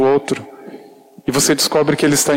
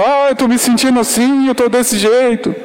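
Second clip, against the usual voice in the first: higher, eager oversharing voice; gushing chatterbox; 8 semitones higher than usual. Retold or imitated. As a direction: imitate someone who spills everything at once. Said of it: "Tem pessoa que é arreganhada".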